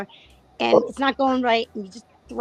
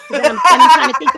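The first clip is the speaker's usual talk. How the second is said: laughing; 5 semitones higher, 14 dB louder than usual. Real, not acted. Laughing while explaining.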